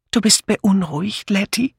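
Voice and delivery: high, timid question; high-voiced worried old friend; anxious Dora. Anxious Dora asks her friend.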